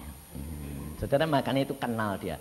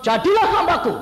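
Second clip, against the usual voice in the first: voice of God; 12 dB louder than usual. Retold or imitated. God's call voiced in testimony.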